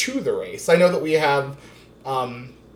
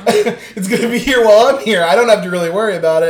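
higher, louder shrugging-off voice; mock careless voter; 5 semitones higher, 8 dB louder, much faster than usual. Mocking voters who ignore climate change, loud careless shrug. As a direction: toss it off like you couldn't care less. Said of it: "a lot of people are like".

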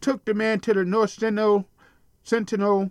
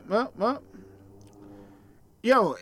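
usual speaking voice

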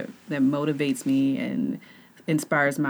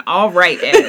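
mimicking co-host; 10 dB louder, slower than usual. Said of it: "it's funny that you're saying".

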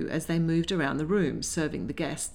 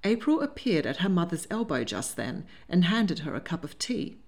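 usual speaking voice

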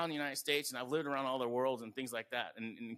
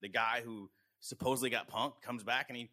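normal talking voice

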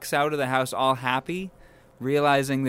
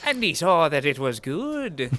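bad Irish accent; 3 semitones higher than usual. Host botches a quote for laughs, bad Irish accent. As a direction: have a clumsy go at an Irish accent. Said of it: "I can't do an Irish voice".

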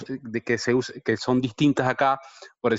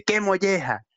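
pitched up, quoting the little son; child repeating it; 8 semitones higher than usual. Retold or imitated. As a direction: mimic the kid copying his dad. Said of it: "me lo repite". (el hijo repitiendo la frase con voz más aguda)

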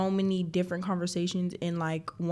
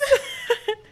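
high, delighted laugh; real laughter; 4 dB louder than usual. Real, not acted. Friend amused at the soulmate talk, high laughter. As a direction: burst out laughing, high-pitched.

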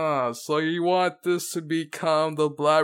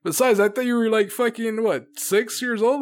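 can't believe it, pitched up; incredulous; 6 semitones higher than usual. Real, not acted. Incredulous mocking.

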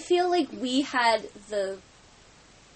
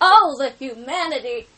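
put-on old newsreel announcer; 3 semitones higher, 10 dB louder than usual. Mock old newscaster exclamation.

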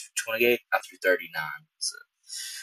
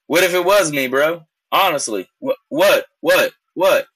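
louder, higher taunting bro; defiant challenge; 6 semitones higher, 4 dB louder than usual. Retold or imitated. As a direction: square up and push back. Confronting the accuser.

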